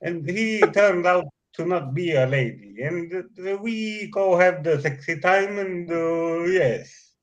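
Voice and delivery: put-on light Finnish-English accent; broken English, mock Finnish guy; Gogas voice. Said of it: "You're spot on with the Gogas".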